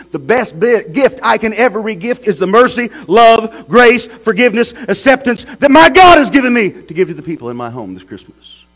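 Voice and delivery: high and loud pulpit exhortation; preaching at full force. Acted. Preacher exhorting the church about giving, full force.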